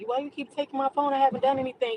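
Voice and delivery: a hint of a grumpy teenage son; mock whiny teen. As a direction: whine like a teen losing his phone. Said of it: "He was mad".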